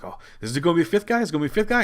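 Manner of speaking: high, fast and excited guessing; a fan hoping for a surprise entrant; eager speculation. Retold or imitated. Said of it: "we're like".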